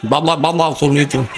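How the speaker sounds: low, mushy বাবা, বাবা without teeth; toothless speech. Shown as a demonstration. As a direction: mimic a toothless old man. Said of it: "জিহ্বা আছে, দাঁত নাই". (দাঁত ছাড়া ফোকলা গলায় বাবা বাবা)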